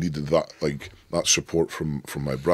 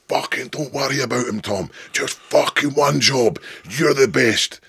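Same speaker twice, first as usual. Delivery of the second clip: much higher, louder shouting to pump him up; fired-up yell; 9 semitones higher, 4 dB louder than usual. Retold or imitated. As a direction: roar it like a corner coach. Yelling encouragement before stone run.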